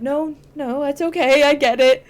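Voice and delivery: mock reassurance. Voices John's reply to apology.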